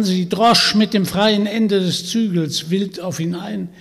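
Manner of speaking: plain reading voice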